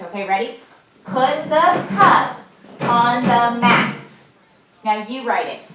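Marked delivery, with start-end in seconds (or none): 1.0-4.0 PUT, THE, CUPS, ON, THE, MATS in slow, loud beats; pounded word by word. Shown as a demonstration. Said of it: "I'm gonna do your desk".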